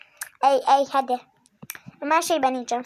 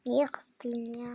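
slow soft unvoiced whisper; 4 semitones lower, 11 dB quieter, much slower than usual. Real, not acted. Asking why he got angry, slow whisper. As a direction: murmur it under the breath.

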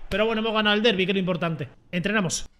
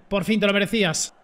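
ordinary delivery

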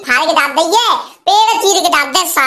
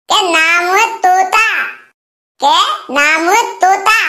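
slow boastful line; slower than usual. Acted.

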